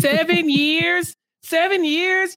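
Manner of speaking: high, slow, drawn-out "seven years"; excited emphasis. Retold or imitated. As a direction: marvel at the long wait. Gushing over the couple.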